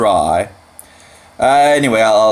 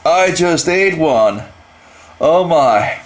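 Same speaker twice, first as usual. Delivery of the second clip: shocked; 7 semitones higher than usual. Real, not acted. Reacts to the swallowed bug.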